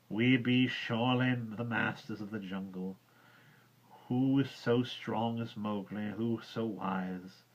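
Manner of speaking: slow, drawling, a hint of mockery; curious drawl. Bagheera half mocks the man cub, slow drawl. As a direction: drawl it as if making fun. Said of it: "a curious drawl in that voice".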